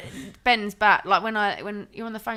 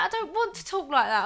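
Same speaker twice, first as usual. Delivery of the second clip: mock protest; 10 semitones higher, 7 dB louder than usual. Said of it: "I was like".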